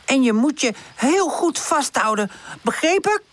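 high-voiced stern warning; mildly strict character voice. A narrator's reading. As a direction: instruct firmly, rising sharply on the check. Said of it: "kijkt Charlie streng aan".

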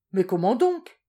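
a loud, hearty exclamation; exclaimed. A narrator's reading. Garcia cries out to the marquis, loud and hearty. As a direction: burst out as if it goes without saying. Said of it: "s'écria Garcia Bonenfant".

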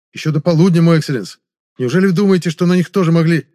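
громкий ответ высоким голосом; отвечай начальнику громко, с тревогой; взволнованный подчинённый. A narrator's reading. Отшатнувшийся подчинённый отвечает наставнику. (взволнованно, повышенным голосом)

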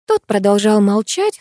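ordinary delivery, nothing marked

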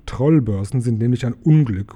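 plain reading voice

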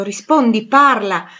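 loud commanding exclamation; loud, imperious. A narrator's reading. Blind master commanding the silent visitor to speak, loud and imperious. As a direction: order an answer forcefully. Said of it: "esclamò con voce alta e imperiosa".

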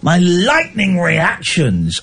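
slow, savoured hammed-up boast; slow mock pride. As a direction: draw out each word grandly. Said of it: "repeat slowly".

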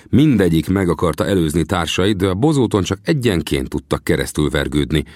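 ordinary delivery

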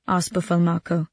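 usual speaking voice

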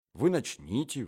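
ordinary delivery, nothing marked

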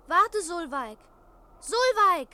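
high, piercing shout; loud child's call, rising in urgency. Acted. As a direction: yell after someone running away. Child calls after departing sister.

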